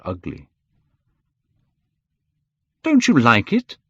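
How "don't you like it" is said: high, louder cry of dismay; cried out. A narrator's reading. Painter cries to his friend, high and loud. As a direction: Hallward cries out, hurt. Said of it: "cried Hallward".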